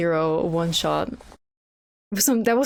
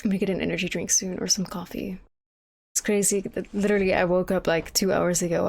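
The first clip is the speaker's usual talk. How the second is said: ordinary delivery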